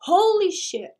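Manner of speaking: a sudden high, loud holy shit; shocked outburst. Real, not acted. Host stunned by what the clip says, loud shocked blurt.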